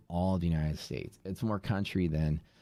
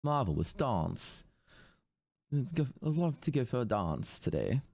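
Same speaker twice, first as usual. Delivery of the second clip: put-on Katharine Hepburn accent; 5 semitones higher than usual. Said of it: "Katharine Hepburn accent".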